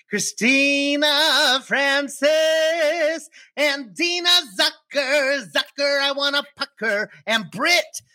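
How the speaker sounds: slow, grand announcer read. Acted. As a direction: announce each name like a host. Announcing patron names.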